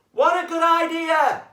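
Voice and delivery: high, loud fox voice; a lively fox wondering aloud; high-pitched cartoon fox. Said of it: "replied fox number three".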